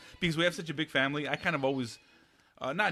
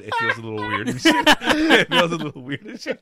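laughing disbelief; 9 semitones higher, 11 dB louder than usual. Real, not acted. Laughing repeat of Steve's claim.

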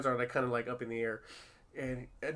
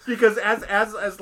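normal talking voice

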